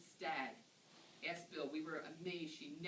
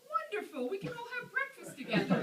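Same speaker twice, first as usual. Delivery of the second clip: much higher and louder, bursting with laughing delight; delighted Honey; 11 dB louder than usual. Retold or imitated. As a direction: squeal it like the best news ever.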